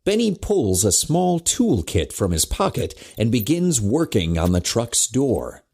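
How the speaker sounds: usual speaking voice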